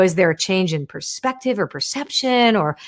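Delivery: ordinary delivery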